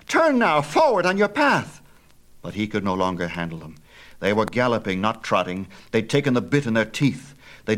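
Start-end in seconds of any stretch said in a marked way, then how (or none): 0.1-1.8 higher, louder pleading command; crying out. A narrator's reading. Phaethon shouting at the horses to turn back. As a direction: beg the horses back to their path with a shout. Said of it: "he cried".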